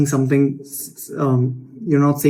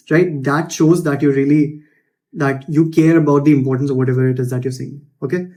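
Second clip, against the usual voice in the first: ordinary delivery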